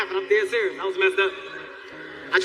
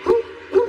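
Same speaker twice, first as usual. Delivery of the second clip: high, hammed-up double whoop; gang-call whoops; 13 semitones higher, 4 dB louder than usual. Bragging to her about the gang, high mock whoops. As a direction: whoop like a wannabe gangster.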